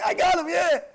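high, loud mock cheer; triumphant hunter. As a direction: whoop like a hunter scoring a hit. Acts out hunter hitting running fox.

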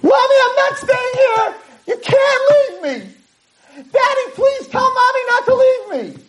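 high-pitched, pleading wail; screaming and yelling. Retold or imitated. Daughter begs daddy to stop mommy leaving, screaming. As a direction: cry out desperately to the parents. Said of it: "I'm watching this screaming and yelling".

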